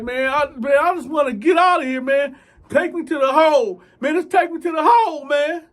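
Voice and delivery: loud, high, fast pleading shout; desperate shouting. Retold or imitated. Thief shouting at the guard, desperate and loud. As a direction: yell to be taken away.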